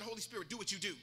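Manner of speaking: low, soft private prayer; softly muttered prayer under the breath. Retold or imitated. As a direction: drop it low, a quick aside to God. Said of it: "under my breath".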